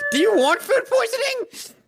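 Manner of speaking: very high squeaky taunt; squeaky mock voice. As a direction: squeak it out mockingly. Squeaky high mocking line.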